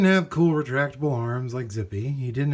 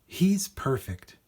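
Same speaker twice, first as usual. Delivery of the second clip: slow, higher, wonderstruck; delighted boy; 6 semitones higher, slower than usual. Acted. Boy delighted by the old robot, slow and wonderstruck. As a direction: savour it like a found treasure.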